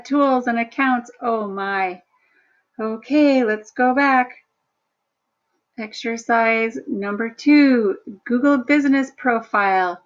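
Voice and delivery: ordinary talk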